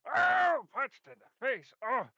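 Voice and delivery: high, loud cry of pain; pained yelp. Acted. Character punched in the face, loud pained yelp.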